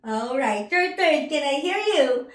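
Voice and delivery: ordinary talk, nothing marked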